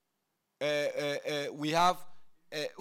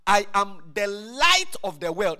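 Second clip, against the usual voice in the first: emphatic declaration; 3 semitones higher, 9 dB louder, slower than usual. Acted. Preacher declaring Jesus' words.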